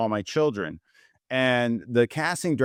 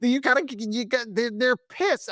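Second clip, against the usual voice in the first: much higher, urgent scolding voice; exasperated manager; 12 semitones higher than usual. Retold or imitated. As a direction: act the manager in disbelief. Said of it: "And she goes".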